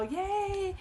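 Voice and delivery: a high little yay; cheer. Real, not acted. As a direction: celebrate yourself playfully. Cheering her two decades.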